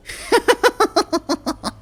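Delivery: very high, fiery creature giggle. Acted. Fire genasi giggling after rising from flames. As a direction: giggle like a newborn fire spirit. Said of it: "It begins to giggle and laugh".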